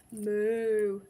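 loud, long moo; cow's moo. A narrator's reading. Cow at the secret meeting, loud moo. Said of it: "said the cow".

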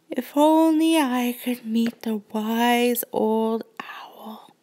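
slow unvoiced whisper while yawning; sleepy yawning whisper. A narrator's reading. Brooke wishing as she falls asleep, slow whisper.

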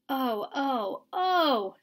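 drawn-out mouse moan; lightly voiced mouse moaning over the disaster. A narrator's reading. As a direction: groan in dismay. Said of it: "Tucker moaned".

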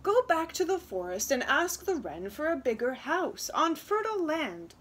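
high, loud, urgent plea; pleading parents. Acted. Parents send daughter back to the wren, pleading. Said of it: "they both pleaded".

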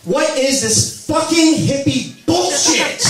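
high, slow mock sneer; scoffing at a hippie film; scornful outburst. Instinctive gut reaction.

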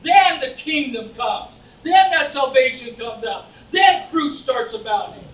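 loud, slow preaching shout; preaching at full force. Acted. Preacher building to a climax for the congregation, loud, slow preaching. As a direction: drive it home to the church, shouting.

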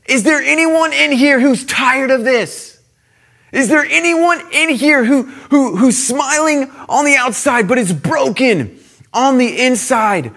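loud, high call across a rowdy party; impassioned public appeal. Acted. Acts student preaching on a table.

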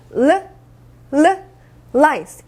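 tongue-tip L in "르, 르, 라이스"; L sound drill. Shown as a demonstration. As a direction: separate L twice, then "라이스" as lice. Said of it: "리을 발음이 나니까요".

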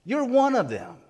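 high-pitched declaration with a falling end; accusing statement. Retold or imitated. Bystanders identifying Peter to his face, accusing statement. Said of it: "You're making a statement".

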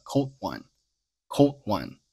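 slow "cold one" with no clear d burst; stop before semivowel. Shown as a demonstration. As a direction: model "cold one" slowly, d swallowed before w. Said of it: "原声中是做了施爆处理".

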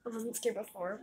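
ordinary talk

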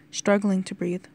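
ordinary delivery

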